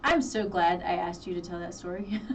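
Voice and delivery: ordinary talk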